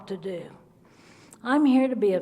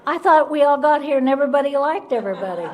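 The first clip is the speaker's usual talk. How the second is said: louder, laughing through the words; 4 semitones higher, 5 dB louder than usual. Real, not acted. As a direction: laugh at your own naive younger self. Laughing at own naivety.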